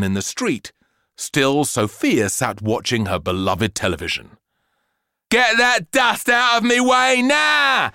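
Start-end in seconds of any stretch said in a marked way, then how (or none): 5.3-7.9 high, loud, slow shout; bratty yell. Acted. Girl yelling about dust blocking her TV, loud shout. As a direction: bellow it like a furious spoiled kid.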